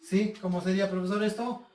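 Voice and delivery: usual speaking voice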